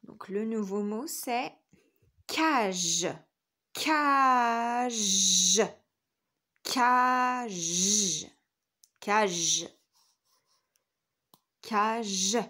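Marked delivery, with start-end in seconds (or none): 2.3-12.4 loud, stretched cage with a long final zh; dictation sounding-out. Shown as a demonstration. Teacher dictating to children, cage said loud and drawn out.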